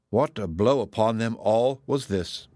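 ordinary delivery, nothing marked

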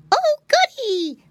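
high, loud, drawn-out piglet cheer; delighted cry. A narrator's reading. Piglet hearing about puddings, delighted cry. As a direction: squeal it slowly with glee. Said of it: "cried Flop Ear".

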